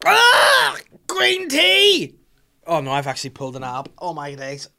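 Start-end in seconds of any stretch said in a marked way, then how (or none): 0.1-2.1 very high, loud cry of ecstasy; wildly overdone shriek of pleasure over tea; hammed-up orgasm scream. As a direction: scream it out in absurd mock climax. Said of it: "the orgasm sound".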